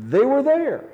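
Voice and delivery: "there" lifted high and loud, falling off; stress on there. Acted. Preacher to congregation, punching "there" high and loud. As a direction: hit "there" hard and high.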